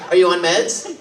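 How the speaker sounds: ordinary talk, nothing marked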